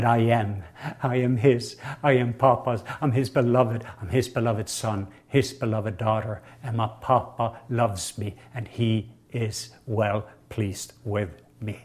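quiet meditative repeat; soft confession. Shown as a demonstration. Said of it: "we're gonna just say that".

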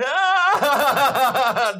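loud, high, stuttering mock wail; hammed-up sobbing cry; imitated crying wail. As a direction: wail like a man bawling, broken into pulses. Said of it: "and he's crying".

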